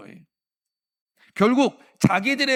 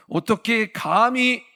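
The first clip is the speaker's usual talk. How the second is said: slow, louder, higher indignant how dare you; mock outrage; 5 semitones higher, 6 dB louder, slower than usual. Preacher voicing an offended believer, slow mock outrage. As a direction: spell out the wounded pride slowly.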